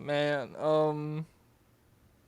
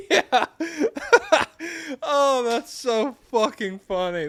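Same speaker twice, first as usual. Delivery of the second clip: high, loud words through real laughter; giggling; 10 semitones higher, 6 dB louder than usual. Real, not acted. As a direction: crack up while you talk. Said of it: "giggling to myself".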